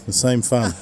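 ordinary talk

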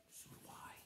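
soft unvoiced whisper. Real, not acted. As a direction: breathe it like a secret. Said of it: "listener whispers along".

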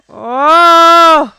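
sudden loud cry at a near miss; stunned outburst; loud gasp of shock. Real, not acted. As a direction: gasp out loud as it nearly goes in.

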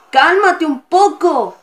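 loud, slow, high shout of frustration; furious shout at the opponent; exasperated yell. Real, not acted. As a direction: yell it at the screen, drawing it out. Frustrated gamer yells at game.

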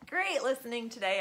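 plain reading voice

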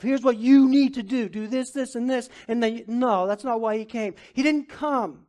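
loud, high, driving sermon delivery; fiery preaching. Real, not acted. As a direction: preach it hard to the congregation. Preaching at full force.

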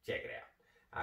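hushed repeat of the plug; whispered aside. Acted. Plugging his video in a secret whisper.

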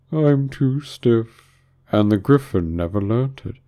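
slow, stretched excuse; doleful Mock Turtle. A narrator's reading. Mock Turtle excusing himself, slow and doleful. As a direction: drag out the opening words.

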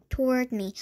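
plain reading voice

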